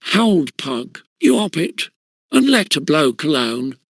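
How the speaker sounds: plain reading voice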